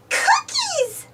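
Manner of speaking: very high squeal of delight; excited squeal. A narrator's reading. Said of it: "the twins squeal".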